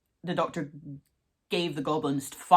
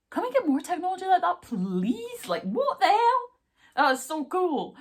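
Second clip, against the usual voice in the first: gushing; 4 dB louder than usual. Real, not acted. Raving to viewers about the gloves, squealing excitedly. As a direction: beg for the tech like a thrilled fan.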